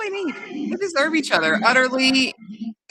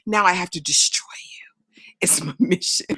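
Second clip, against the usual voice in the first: hammed-up menacing whisper; low, breath-only mock threat; villainous whisper; 5 semitones lower than usual. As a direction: whisper it like a melodramatic vow of revenge. Acts villainous vow of revenge.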